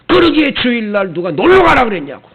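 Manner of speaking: retold scolding. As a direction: scold them for going out on the Lord's day. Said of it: "막 이렇게 야단을 칩니다".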